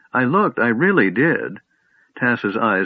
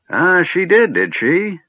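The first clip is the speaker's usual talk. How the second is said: put-on muttering old wizard; 10 semitones higher, 4 dB louder, slower than usual. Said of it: "The old man muttered".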